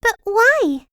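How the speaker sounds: put-on high, startled girl voice; surprised question. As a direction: Sunshine surprised at her arrest, high. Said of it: "asked Little Miss Sunshine".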